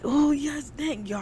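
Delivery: high, grateful exclamation; retold relief. Relief when car starts.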